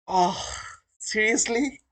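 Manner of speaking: can't believe his hero is here; stunned disbelief. Real, not acted. Streamer seeing his mentor in chat, high stunned disbelief.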